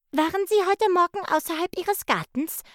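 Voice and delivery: hoch und laut, beharrlich nachgefragt; nachbohrend. A narrator's reading. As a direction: bohr hartnäckig nach. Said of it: "bohrte Lotte nach". (Lotte bohrt beim Mops energisch nach)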